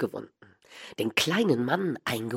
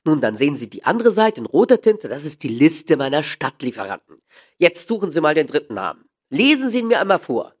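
louder, insistent shop dealer; brusque dealer; 3 semitones higher, 7 dB louder than usual. Acted. Dealer challenging Holmes over his account books, loud and insistent. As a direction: order the customer about, voice raised.

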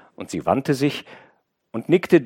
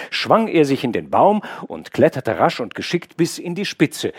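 ordinary delivery, nothing marked

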